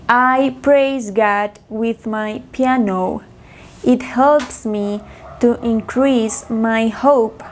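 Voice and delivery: slow, evenly spaced words; slow practice model. Shown as a demonstration. Teacher repeating practice sentences for students to copy, slowly. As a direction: read it slowly so learners can follow. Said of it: "yo repito tres veces".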